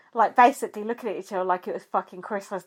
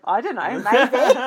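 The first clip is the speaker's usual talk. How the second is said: amused retelling; 2 semitones higher, slower than usual. Retold or imitated. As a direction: quote tipping into laughter. Retold coy answer, then laughing.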